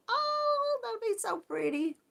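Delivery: a high, swooping oh of delight. Real, not acted. Host reacting to a viewer's newborn dress, delighted high oh.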